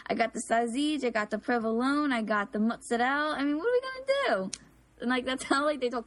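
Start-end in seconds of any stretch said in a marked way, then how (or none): 0.1-4.5 high-pitched South Philly Italian caricature; pushy Italian-American relative impression; loud, obnoxious Italian-American accent. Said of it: "They're really loud, very obnoxious".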